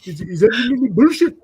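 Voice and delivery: mock indignation, drawn out and loud; upset clerk. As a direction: imitate a clerk getting worked up. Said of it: "he starts getting upset".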